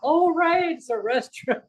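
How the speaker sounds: high, drawn-out "oh, right"; put-on high-voiced correction; mock realization. Acting out a washroom mix-up.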